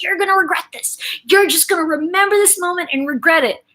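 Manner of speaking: mock a huffy parting threat; mad father. Daughter mimicking her dad on the stairs, indignant. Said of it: "turns around to me and says".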